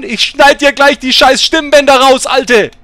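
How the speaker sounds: high, loud angry yell; enraged high-pitched outburst; furious threat. Real, not acted. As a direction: shout it slowly at the nagging passenger. Rages at complaining passenger.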